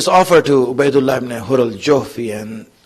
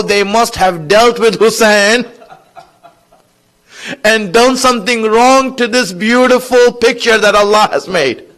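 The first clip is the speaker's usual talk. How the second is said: raised, emotional sermon voice; impassioned preaching; 7 semitones higher, 4 dB louder than usual. Acted. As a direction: preach with mounting grief, stretching the key words. Preaches at rising emotional force.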